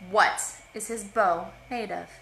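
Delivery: loud, booming challenge; strong as thunder. Acted. The being's sister testing the liars, thundering voice. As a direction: hit it like a thunderclap. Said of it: "her voice had shifted as lightning and strong as thunder".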